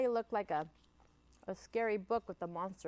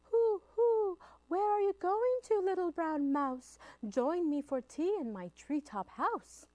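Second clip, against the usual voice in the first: high, louder owl voice with hoots; hooting owl; 11 semitones higher, 4 dB louder than usual. Acted. The owl inviting the mouse to tea, high and hooting. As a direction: hoot it out like an owl.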